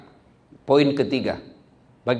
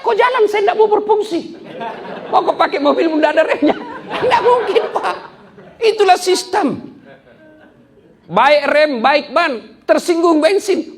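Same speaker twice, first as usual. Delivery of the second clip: high, loud, laughing mock huff; offended brake; 12 semitones higher, 4 dB louder than usual. Preacher voicing a sulking brake, high and laughing. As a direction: ham up a sulky car part refusing to work.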